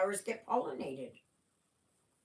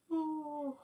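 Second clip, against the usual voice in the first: yawning; 8 semitones higher, 3 dB quieter than usual. Real, not acted. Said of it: "I don't know why I'm yawning".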